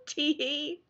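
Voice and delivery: coy, high little tee hee; a fake girly giggle; mock giggle. Reacting to a rude comment with a mock giggle.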